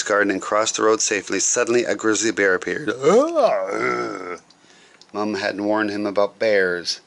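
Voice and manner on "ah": scared cry. Acted. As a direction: shriek at the sudden bear. Grizzly bear appears.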